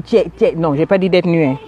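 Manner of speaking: ordinary talk, nothing marked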